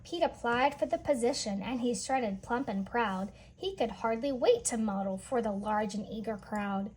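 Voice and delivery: plain reading voice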